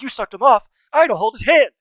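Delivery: loud, high mock outrage; mock indignant shout. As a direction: yell it in fake protest. Mock quote, loud laughing.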